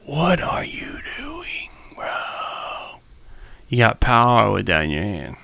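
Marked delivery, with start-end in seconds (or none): 0.1-3.0 slow whispered disbelief. Real, not acted. Vlogger still stunned, whispering slowly. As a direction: breathe it out, shocked.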